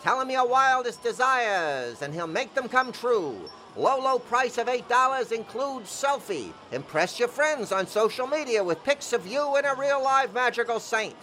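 carnival barker. Acted. Carnival barker pitching Santa photos.

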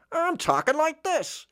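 very high, slow put-on accent; twin's foreign accent. Playing the twin sent over there, exaggerated other accent. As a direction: stretch it out in a silly, high-pitched accent. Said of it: "Look at your twin that was removed".

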